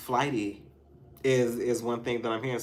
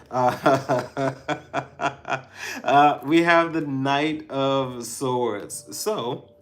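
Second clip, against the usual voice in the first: laughing through the words; breaking into giggles while announcing; 4 semitones higher, 10 dB louder, much slower than usual. Real, not acted. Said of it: "I'm laughing because".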